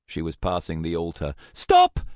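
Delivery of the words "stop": very high, loud yell; piercing one-word scream; shout. A narrator's reading. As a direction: shout a desperate command. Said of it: "Rachel shouted".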